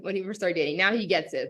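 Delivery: ordinary talk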